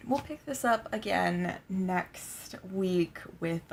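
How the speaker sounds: usual speaking voice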